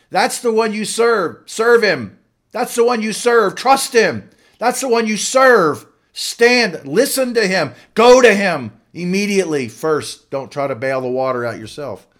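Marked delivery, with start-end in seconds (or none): 0.1-9.7 much higher, louder fervent preaching; preaching at full force. Acted. Preacher urges the congregation to serve Jesus, fervent shouted preaching. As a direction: drive it home to the congregation.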